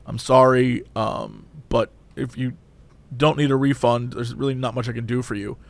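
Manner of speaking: low, soft, halting apology; poor cashier. Retold or imitated. Cashier apologizing to angry customer, low and subdued. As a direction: imitate a subdued employee apologizing. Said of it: "yelling at this poor guy".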